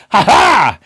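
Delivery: loud, high taunting ha-ha; yelling. Acted. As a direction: yell it to tease. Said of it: "And they're yelling".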